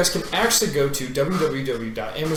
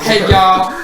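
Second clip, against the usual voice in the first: loud, high Texan greeting; Carrie's Texas twang; 5 semitones higher, 10 dB louder than usual. Retold or imitated. [name] imitating Carrie, loud high Texan hello. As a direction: holler it like Carrie would. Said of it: "He talks like that".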